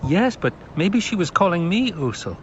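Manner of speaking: put-on a boy baffled by his own dream; puzzled boy. Paul's boyish voice.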